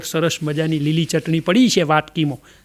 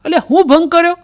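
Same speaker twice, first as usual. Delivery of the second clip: much higher, louder protest; indignant surprise; 10 semitones higher, 4 dB louder than usual. Acted. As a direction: protest in shock. Devotee shocked at accusation.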